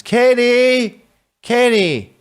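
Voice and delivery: high, loud call across the room; mock junkie calling her name. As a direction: call her back before she leaves. Calling girlfriend back.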